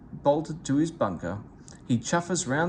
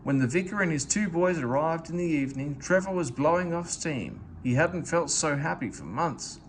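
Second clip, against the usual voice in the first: usual speaking voice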